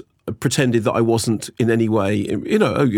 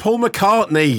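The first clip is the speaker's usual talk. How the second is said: awed fan; 9 semitones higher, slower than usual. Retold or imitated. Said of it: "And you go".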